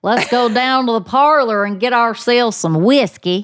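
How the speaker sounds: hammed-up Southern twang; exaggerated Southern accent. Shown as a demonstration. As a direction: ham up a hokey Southern party-host accent. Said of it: "a pretty good Southern accent".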